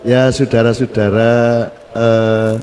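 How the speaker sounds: low, very slow, hesitant minister voice; imitating Murdiono's halting speech. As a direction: mimic a minister dragging out every word. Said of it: "Jadi Pak Murdiono kalau". (tiruan Murdiono bicara lamban dan rendah)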